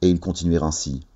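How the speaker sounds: ordinary delivery, nothing marked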